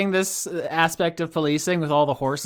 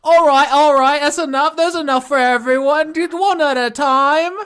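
put-on high, loud cool-girl crowd control; acting as Marina; 11 semitones higher, 5 dB louder than usual. Marina fending off fans, high and loud. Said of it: "And she's like".

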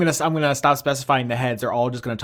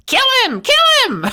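shrieking very high and loud; frenzied shout; 9 dB louder, faster than usual. Acted. Monster head screaming for the kill of the stranger. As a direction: scream it like a bloodthirsty little head.

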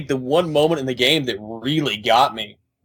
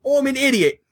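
high-pitched mock Welsh accent; hammed-up Welsh voice, pitched way up; mock her in a silly, high Welsh accent; Meryl; 13 semitones higher than usual. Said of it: "That was Meryl".

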